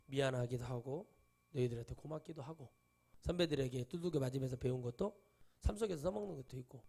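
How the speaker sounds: ordinary talk, nothing marked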